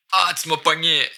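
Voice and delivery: playful little outburst; acted caught out. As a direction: exclaim as if nabbed in the act. Acts out getting caught stealing candy.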